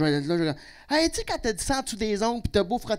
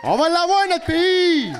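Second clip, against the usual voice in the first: much higher and louder, rally shout; patriotic rally cry; 10 semitones higher, 8 dB louder, slower than usual. Acted. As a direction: bellow it like a separatist rally. Cri souverainiste parodique. (cri de ralliement souverainiste)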